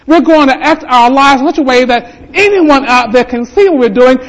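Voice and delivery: high, loud preacher's cry; full-force preaching. Acted. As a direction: lift the whole church with it.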